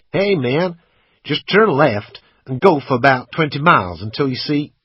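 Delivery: put-on loud, high voice of a young driver; young American driver. A narrator's reading. Driver directs lost stranger down the road, American dude voice.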